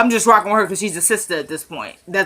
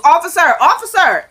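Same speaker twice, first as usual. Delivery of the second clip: calling out; 7 semitones higher, 6 dB louder than usual. Retold or imitated. Inmate calling for the guard.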